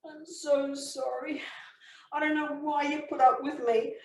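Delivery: high, tearful pleading apology; acted weepy, desperate contrition; remorseful husband. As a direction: beg forgiveness, close to sobbing. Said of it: "The remorse is genuine".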